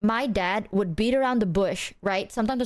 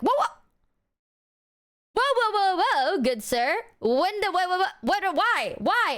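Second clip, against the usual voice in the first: mock alarm; 7 semitones higher than usual. Reacting to being grounded.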